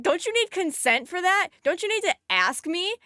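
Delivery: high-pitched, indignant disbelief; incredulous. Retold or imitated. Inner rant at a pushy guy, high incredulous tone. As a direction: rant at him as if it's obvious.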